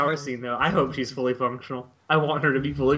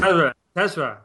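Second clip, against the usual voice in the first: put-on "Tesla" as TESS-rah, louder, Japanese-accented; mock Japanese accent; 5 dB louder than usual. Quoting anime characters to friends, mock Japanese-accented "Tesla". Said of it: "hearing some of the characters pronounce Tesla".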